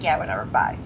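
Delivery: low, dismissive brush-off. Retold or imitated. Boss brushes off an unhappy interviewee, dismissive. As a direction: toss it off, low and uninterested. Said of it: "he doesn't care".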